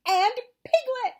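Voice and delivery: high, loud, stretched exclamation; thrilled high cry; excited. A narrator's reading. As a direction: shout the discovery with delight. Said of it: "said Christopher Robin excitedly".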